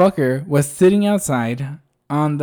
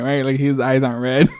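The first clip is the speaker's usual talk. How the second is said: ordinary talk, nothing marked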